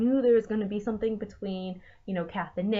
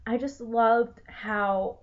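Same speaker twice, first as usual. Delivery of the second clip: ordinary delivery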